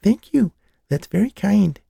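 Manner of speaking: high, dainty English doll voice; a touch of English lilt, played high and prim. Little girl voicing a second doll, with an English lilt. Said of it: "with a bit of an English lilt".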